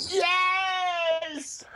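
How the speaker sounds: long, high excited shriek; excited squeal of celebration. Real, not acted. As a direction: draw out a high, thrilled cheer. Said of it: "Drew's very excited".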